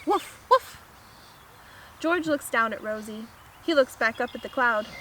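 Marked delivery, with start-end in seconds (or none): 0.0-0.8 high double woof; excited barking. A narrator's reading. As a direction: bark eagerly like a dog. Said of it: "she shouts".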